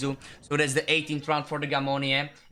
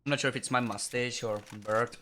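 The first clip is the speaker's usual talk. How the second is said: usual speaking voice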